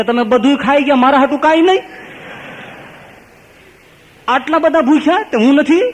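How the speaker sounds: very high, loud mock wail; hammed-up tearful protest, pitched right up; acted wailing. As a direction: cry out the complaint like a bawling devotee. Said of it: "ધનો પોક મૂકીને રોવા માંડ્યો".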